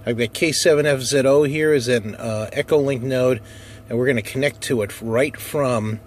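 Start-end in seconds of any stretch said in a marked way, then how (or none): none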